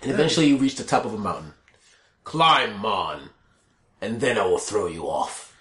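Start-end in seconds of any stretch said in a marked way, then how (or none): 2.2-5.5 low, gloating monster voice; a rock brute promising a fall; barbarian. Acted. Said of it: "the cries of the climb mon making words".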